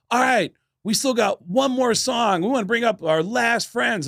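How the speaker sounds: high, stretched-out concert announcement; mock frontman addressing the audience; imitated stage announcer. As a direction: work the crowd before the last number. Band announces final song from stage.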